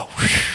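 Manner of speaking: long blown breath like wind; wind whoosh. Retold or imitated. Preacher voicing the breath of God, a long whoosh. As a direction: blow it out as a gust.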